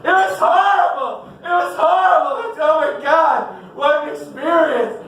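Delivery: high, shrieking mock horror; hammed-up hysterical woman leaving the theater; mock screaming women. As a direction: shriek it like a traumatized moviegoer. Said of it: "women coming out going".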